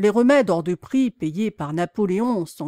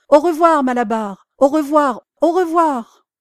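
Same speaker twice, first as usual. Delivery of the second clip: loud high farewell calls; chorus of farewells; 5 semitones higher, 5 dB louder than usual. A narrator's reading. Animals calling goodbye to Malabar, loud and high. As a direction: call out goodbye after the departing van. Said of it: "criant en chœur".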